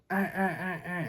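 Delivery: high, sharp repeated ah calls; high duck call sounds. Shown as a demonstration. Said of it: "I'm gonna hit 'em with".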